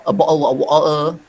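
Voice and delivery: Cockney, with the t's dropped to glottal stops; Cockney swallowed t's; Cockney accent. Shown as a demonstration. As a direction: demonstrate a Cockney glottal "bottle of water". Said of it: "that is a Cockney accent".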